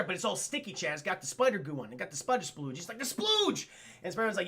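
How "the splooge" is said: very high squeal; mock dismay. Hobgoblin finding his glider all sticky, high mock-dismayed cry.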